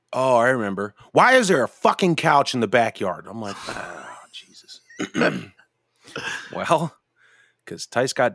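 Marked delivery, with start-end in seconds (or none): 0.1-3.3 louder, higher mock scolding; exasperated wife. Wife on the phone asking about the couch, mock exasperated scolding. 3.7-4.8 slow, breathy whispered dread; whispered groan. Real, not acted. Husband caught out by the wife about the couch, slow whispered groan.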